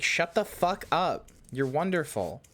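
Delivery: slow, high, emphatic scolding; exasperated affection. Retold or imitated. Streamer cutting off self-deprecating chatters, exasperated but fond. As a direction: scold them slowly into accepting a compliment. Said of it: "I have to be like".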